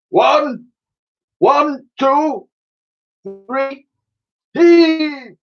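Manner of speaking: huge trumpeting high cry; deafening mammoth hurrah. Acted. Mammoth coughing out water, deafening hurrah. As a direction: bellow it like a soaked mammoth. Admiral counts the heave.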